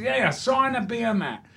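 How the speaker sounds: high, insistent Cockney. Retold or imitated. Cockney stranger pestering actor for an autograph, pushy high Cockney. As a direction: demand it like a pushy Cockney fan. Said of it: "this Cockney comes in".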